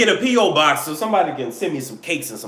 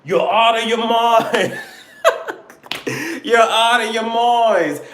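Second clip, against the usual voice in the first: put-on slow, higher Caribbean-British mishmash accent; muddled put-on accent; 4 semitones higher than usual. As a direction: laugh through a confused comic foreign accent. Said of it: "I don't know what accent I'm doing".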